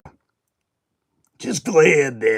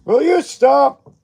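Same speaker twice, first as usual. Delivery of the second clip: much higher, louder, a bit slow, stressing you; exasperated commentator line; Gorilla Monsoon; 12 semitones higher, 6 dB louder than usual. Retold or imitated. Streamer imitating Gorilla Monsoon's catchphrase, high and loud. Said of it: "Classic Gorilla?".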